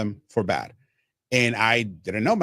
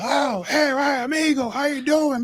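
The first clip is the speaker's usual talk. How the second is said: put-on high, hearty old-timer greeting, well up in pitch; imitating Mike Shannon. The announcer welcoming the new guy, in a high hammed-up hearty voice. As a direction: greet a newcomer like a big-voiced veteran broadcaster. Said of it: "He's like".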